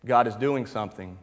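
ordinary delivery

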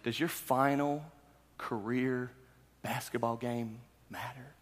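ordinary talk

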